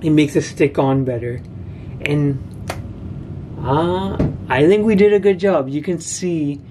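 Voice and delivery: lips held off teeth; careful stiff mouth speech; whitening strips on teeth. Real, not acted. Seen 0: Strips applied at -5, teeth coated throughout, shown again +9.9.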